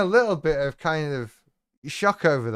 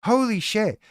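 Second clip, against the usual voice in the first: higher exclaimed holy shit; mock shock; 6 semitones higher than usual. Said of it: "Their comment is gonna be".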